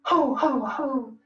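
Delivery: belly-laugh like Santa; Santa Claus laugh. Shown as a demonstration. Teacher doing Santa's ho ho ho for breathing. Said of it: "Think of Santa Claus when you go".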